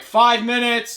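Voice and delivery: loud, high countdown call; loudspeaker announcement. Retold or imitated. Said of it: "like, over the loudspeaker".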